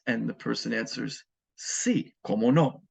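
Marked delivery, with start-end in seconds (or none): 1.6-2.8 emphatic yes of course. Shown as a demonstration. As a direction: say sí with a big emphatic leap up. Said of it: "very emphatically".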